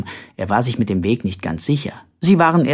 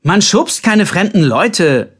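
raised, scolding call; calling after someone; 6 semitones higher, 5 dB louder, slower than usual. A narrator's reading. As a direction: call out a reprimand after him. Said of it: "rief Colbert ihm hinterher".

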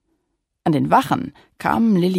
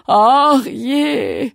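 sobbing; 8 semitones higher, 7 dB louder than usual. Acted. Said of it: "schluchzte er".

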